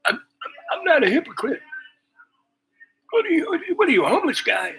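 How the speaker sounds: very high, loud, stammering outrage; mock indignant woman. Accused woman firing back at the storyteller, mock indignation. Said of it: "she looked at me like".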